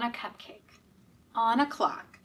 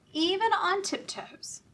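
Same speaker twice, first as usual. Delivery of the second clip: ordinary delivery, nothing marked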